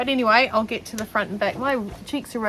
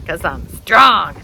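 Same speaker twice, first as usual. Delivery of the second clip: mock proud boast; 9 dB louder, much slower than usual. Boasting after bee sting.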